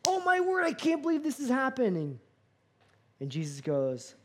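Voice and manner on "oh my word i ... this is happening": high, amazed gasp of disbelief; can't believe what you're overhearing; stunned disbelief. Retold or imitated. Eavesdropper in a diner booth to himself, high amazed disbelief. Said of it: "And you're like".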